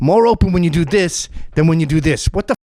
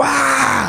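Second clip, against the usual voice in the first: pained cry; 10 semitones higher than usual. Retold or imitated. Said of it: "and they'll go".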